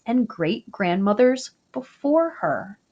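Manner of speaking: ordinary delivery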